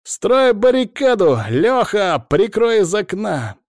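high, loud, slow yelled commands; shouting orders. A narrator's reading. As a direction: yell orders across the room. Dym yelling orders in fight.

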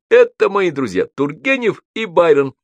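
loud, high introduction; shouting. A narrator's reading. Аксаков introduces his friends to Гоголь, shouting. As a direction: announce your friends at a shout. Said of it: "кричал Аксаков".

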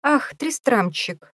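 put-on high, slow cooing baby talk; lisping baby talk. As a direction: coo the name with fake tenderness. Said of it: "засюсюкал Дерек".

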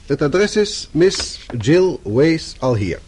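slowly spelling out the address for the typist; slow dictation. Acted. Boss dictating the address to his secretary, very slowly.

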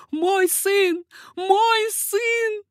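high, loud, desperate cry; mother's cry. A narrator's reading. Mother begging for her only child's life, crying out. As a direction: cry out high for your son. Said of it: "кричала мать".

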